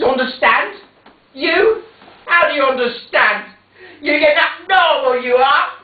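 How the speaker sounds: high, loud scornful mother; scornful outburst. Acted. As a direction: she mocks him, rising and loud. Mother berates son.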